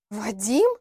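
name echoed as a rising question; startled question. Acted. Лилиана echoes the name the girl gave, taken aback.

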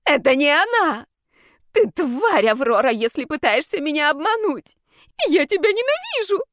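high, loud accusing cry; acted hysterical fury; frantic, hateful outburst. Frantic patient screams hatred at Aurora.

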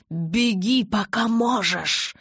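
loud, slowing menace; fierce, drawn-out threat; threatening. A narrator's reading. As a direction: raise your voice and stretch out the warning. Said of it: "пригрозила я".

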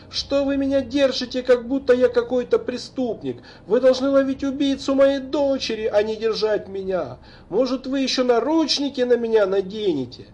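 put-on high, angry drunk tirade; drunken rant. A narrator's reading. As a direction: rail at the police drunk. Drunk father rants at police. (пьяная гневная тирада)

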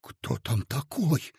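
soft, slow, crushed whisper; whispered question. A narrator's reading. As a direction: ask it under your breath. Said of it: "шёпотом спросил убитый Коротков".

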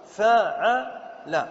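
فاعل very slow, as fa-'a-la; syllable-by-syllable demonstration. Shown as a demonstration. Teacher giving the word's pattern, فاعل in slow syllables fa-'a-la. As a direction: draw فاعل out as fa... 'a... la to match the example. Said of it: "كتب وزنها".

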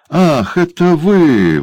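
a sneering dwarf's mock surprise; dwarf exclaims. A narrator's reading. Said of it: "воскликнул карлик". (насмешливое удивление карлика)

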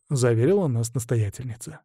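usual speaking voice

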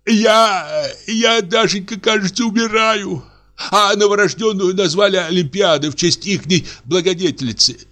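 high whimpering voice of a man who thinks he is dying; frightened old clerk. A narrator's reading. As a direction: tremble through it, half crying. Reads the poisoned man.

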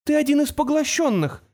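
very high, distraught accusation; breaking voice. A narrator's reading. Girl confronts the creature, voice breaking. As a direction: accuse it with your voice close to breaking. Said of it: "срывающимся голосом продолжила Тамара".

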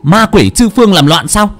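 high, loud furious shout. Acted. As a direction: yell to banish the demons. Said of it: "bà vừa hét lên".

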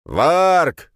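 sharp loud high call; shouting. A narrator's reading. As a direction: bark out a name from the side. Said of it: "крикнул кто-то сбоку".

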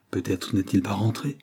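ordinary delivery, nothing marked

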